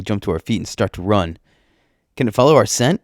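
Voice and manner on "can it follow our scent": higher, louder urgent question; worried shout. A narrator's reading. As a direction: shout it while fleeing. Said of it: "I shout, wondering how it found us".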